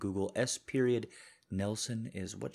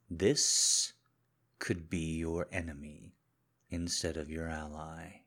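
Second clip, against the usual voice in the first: controlled menacing husband; 3 semitones lower than usual. Acted. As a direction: speak low and controlled, as if you already know. Husband confronts wife, audio drama.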